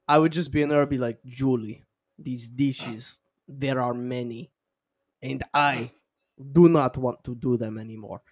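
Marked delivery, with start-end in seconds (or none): 1.2-8.2 put-on low, soft deadpan Russian accent; solemn mock Russian. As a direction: recite it in halting Russian-accented English. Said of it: "Dude, that's scary good".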